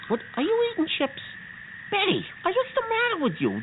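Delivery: can't believe she's eating chips; exasperated surprise. Acted. Catches Betty eating chips.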